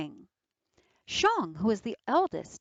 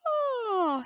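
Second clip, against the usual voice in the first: high, loud, fake yawn; wolf faking sleepiness to the girls with a high, put-on yawn; 3 dB louder than usual. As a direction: yawn like you're pretending to be sleepy. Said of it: "he yawned".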